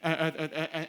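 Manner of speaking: ordinary talk, nothing marked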